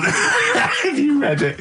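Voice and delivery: slow, high, laughing through the words; mock his question while cracking up; giggling disbelief; laughing echo. Real, not acted. Echoing Karl's question, laughing.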